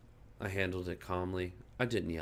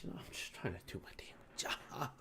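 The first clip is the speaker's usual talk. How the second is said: hissed under the breath; fuming whisper; 7 semitones higher, 9 dB quieter than usual. Real, not acted. Vlogger muttering about the customer, angry whisper. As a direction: whisper the grievance through irritation. Fuming aside while venting.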